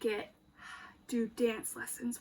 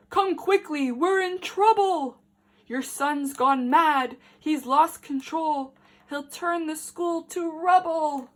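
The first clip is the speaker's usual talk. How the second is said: urgent headmaster; 3 semitones higher, 7 dB louder than usual. Acted. Headmaster phoning dad in a panic, loud urgent plea. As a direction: sound the alarm down the phone.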